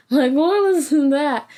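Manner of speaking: hammed-up startled demand; mock possessed shout; woman possessed. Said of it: "Like a woman possessed".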